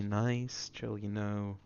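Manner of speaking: low, soft, laid-back delivery; relaxed and low-key; chill vibes voice. Shown as a demonstration. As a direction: settle down into a mellow, low voice. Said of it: "chill vibes voice".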